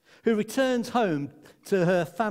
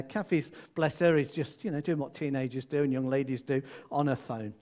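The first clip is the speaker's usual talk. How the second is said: ordinary delivery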